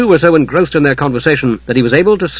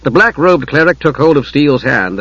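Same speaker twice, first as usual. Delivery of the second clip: plain reading voice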